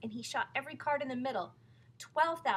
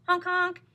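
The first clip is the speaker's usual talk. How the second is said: loud, high honking noise; car horn honk; 9 semitones higher, 12 dB louder than usual. A narrator's reading. Lafcadio honking the horn in the parade. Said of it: "he honked the horn".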